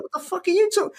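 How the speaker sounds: high, incredulous outburst; retold disbelief. Comedian reliving his shock at the host, high incredulous outburst. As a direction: shoot the pitch way up in disbelief. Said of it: "It's like".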